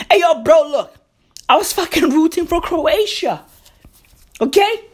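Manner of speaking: usual speaking voice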